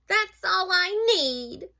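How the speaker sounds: high, loud, drawn-out storybook complaint; exasperated sigh. Acted. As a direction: groan in comic exasperation as a mouse. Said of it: "sighed Mouse".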